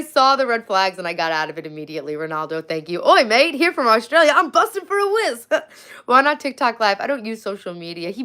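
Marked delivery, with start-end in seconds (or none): 3.0-5.5 hammed-up Aussie bloke, higher and louder; a playful over-the-top Australian; Australian accent. Teasing viewers by putting on an Australian accent. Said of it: "That's an Australian thing".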